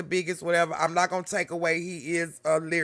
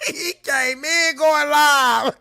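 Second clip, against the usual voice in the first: mock hype; 6 semitones higher, 5 dB louder, much slower than usual. Mocking rapper's entrance.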